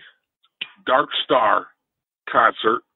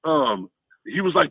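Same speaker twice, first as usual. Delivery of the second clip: ordinary talk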